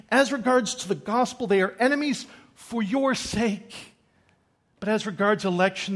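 loud, high, driving proclamation; ringing pulpit reading; forceful scripture reading. Real, not acted. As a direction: read scripture out with rising fervour.

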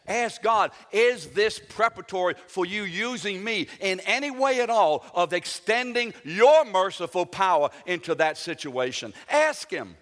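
loud, high-pitched exhortation; full-force preaching. Acted. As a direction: press the congregation hard, hitting the key words. Urges congregation at full force.

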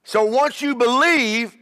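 rising, emphatic sermon cadence; fiery preacher driving the point home; preaching at full force. Acted. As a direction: preach it, building to each "Why?". Preaching climax.

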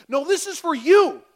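firm loud correction; emphatic no. Real, not acted. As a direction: declare it straight to them, loud. Emphatic rebuttal to congregation.

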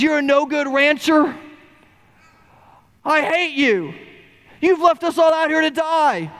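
put-on first round of the boy's angry shout; angry yell. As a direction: hurl it at the rancher. Boy yells at rancher.

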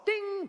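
high chime imitation; ping like an email alert; ding sound. Storyteller mimics the email chime, high ding.

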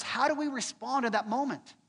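ordinary talk, nothing marked

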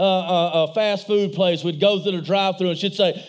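usual speaking voice

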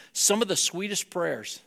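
normal talking voice

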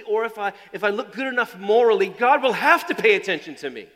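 loud, driving sermon build; preaching. Acted. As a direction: preach it hard to the congregation. Preaching at full force.

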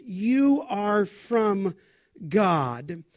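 slow, drawn-out stress on "You"; emphatic YOU. Real, not acted. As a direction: lean on "You" and stretch the phrase slowly. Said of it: "there is emphatic".

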